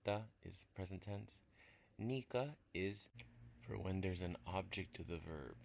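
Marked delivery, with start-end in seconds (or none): 2.0-3.1 Nika said louder, drawn out and falling; teacher hitting Nika for learners; stress on Nika. Shown as a demonstration. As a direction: lean on the suffix Nika. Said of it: "stressing the suffix being taught".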